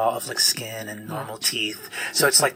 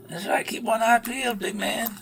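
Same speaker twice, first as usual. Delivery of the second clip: higher, taunting voice; sneering Skinner; 11 semitones higher than usual. Acted. Skinner taunting the paladin, higher and sneering. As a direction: needle the big man. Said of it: "Skinner looks over and says".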